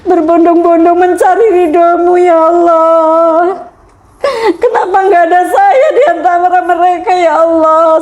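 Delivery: weep to God, asking why not me; crying prayer. Retold or imitated. Watching pilgrims on TV, lamenting to Allah in tears. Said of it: "Saya bilang".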